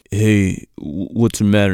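low-pitched mock concern; imitated boyfriend. Boyfriend consoling in retold scene.

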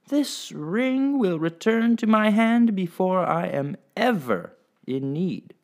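high, slow, defiant oath; lady vowing in proud fury. Acted. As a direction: swear it in rage. Lady's furious vow.

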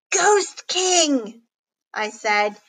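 high, alarmed announcement; hammed-up cry of dread; dramatic alarm. A narrator's reading. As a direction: announce the villain in mock dread. Scared child announces villain's return.

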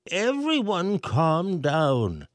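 slow, high mayor voice; calm the crowd slowly. A narrator's reading. Mayor calming the panicked crowd, slow. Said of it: "the mayor said".

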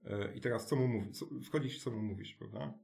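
ordinary talk

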